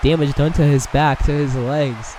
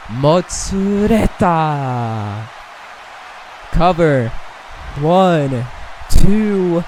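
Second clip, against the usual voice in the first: excited near-fall count; 3 semitones higher, much slower than usual. Real, not acted.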